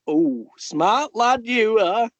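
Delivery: high, slow put-on old woman's voice; mock old lady. Imitating a woman who praised him, high and slow. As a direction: squeak it out like a prim old lady. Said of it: "She went".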